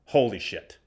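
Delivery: ordinary talk, nothing marked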